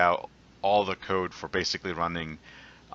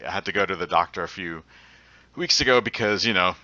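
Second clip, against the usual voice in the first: ordinary talk, nothing marked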